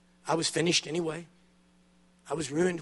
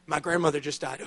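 normal talking voice

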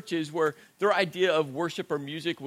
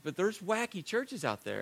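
normal talking voice

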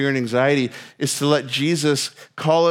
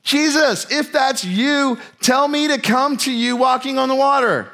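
much higher, slow and pleading; eager Peter; 9 semitones higher, slower than usual. Retold or imitated. Peter shouting to Jesus from the boat, high eager call. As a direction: call out boldly across the water, pitched up. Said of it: "Remember what Peter does?".

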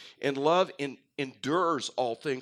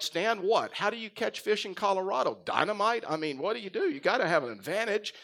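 higher, incredulous and playful; mock disbelief; 7 semitones higher than usual. Pastor retelling his shocked reply to the retired game warden, higher. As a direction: act stunned at the news, hammed up. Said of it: "I'm like".

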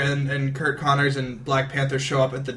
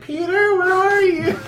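mock damsel cry; 5 dB louder than usual. Mary Jane calls out in danger.

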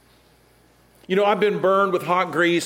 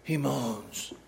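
low moan; 5 semitones lower, 11 dB quieter than usual. Shown as a demonstration. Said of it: "what moans means".

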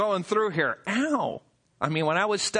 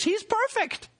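high, mocking "He's perfect"; sarcastic; slower than usual. Real, not acted. Preacher mocking older son's self-image, sarcastic and high. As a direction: sneer it in a sing-song. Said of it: "bad case of self-righteousness".

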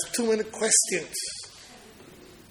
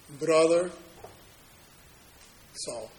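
tender greeting; 3 semitones lower, slower than usual. Acted. Preacher voicing Ananias tenderly.

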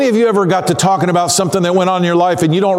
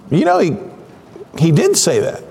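thinking it over. Acted. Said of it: "they're having to think about it".

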